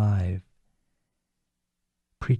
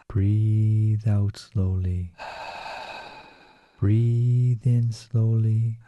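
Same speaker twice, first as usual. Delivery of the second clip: slow breathing cues; much slower than usual. Acted. Pacing breaths in meditation.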